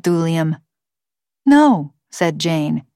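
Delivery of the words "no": higher, louder one-word rebuff; a touch of sharp authority; firm refusal. A narrator's reading. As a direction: cut the child off with a firm no. Jane flatly refuses the girl.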